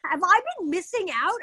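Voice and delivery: high, astonished question; amazed disbelief. Retold or imitated. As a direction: gasp it out, amazed. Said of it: "And I'm like".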